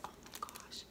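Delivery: breathless whispered oh my gosh; hushed delight; whispered awe. Real, not acted. Looking at gift items.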